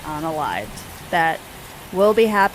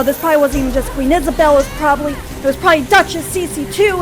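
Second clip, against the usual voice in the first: raised, loud, insistent fury; indignant rant; 6 semitones higher, 7 dB louder, faster than usual. Acted. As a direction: build into a faster, higher accusing tirade. Theodora acted ranting against her enemies.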